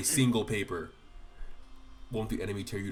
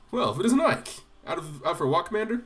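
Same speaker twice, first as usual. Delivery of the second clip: louder and higher, jovial greeting; boisterous Gatrie; 9 semitones higher, 8 dB louder than usual. Pretend. Player voicing Gatrie hailing Ike in a hearty loud voice. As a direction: hail the commander heartily.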